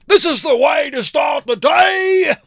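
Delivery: high, loud crowed shout; crowing rooster. Acted. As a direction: crow it like a pumped-up rooster. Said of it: "he crowed".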